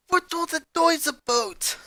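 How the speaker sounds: put-on an irritated father asking in a thick Spanish accent. A narrator's reading. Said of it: "in a thick Spanish accent".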